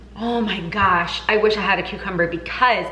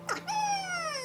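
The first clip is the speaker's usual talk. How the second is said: squeaky laugh; 13 dB quieter than usual. Real, not acted. Laughs at own avocado method.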